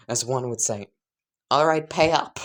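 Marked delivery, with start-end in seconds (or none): 1.5-2.4 high, loud mock Mexican accent; hammed-up Mexican accent, collecting a bet; a jokey Mexican accent, louder and higher. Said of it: "like, in a Mexican voice".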